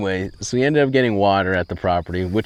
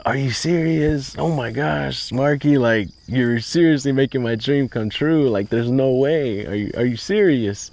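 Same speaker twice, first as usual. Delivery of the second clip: high, thrilled and unbelieving; can't believe the good news; thrilled disbelief; 6 semitones higher than usual. Retold or imitated. Loan approved, dream comes true.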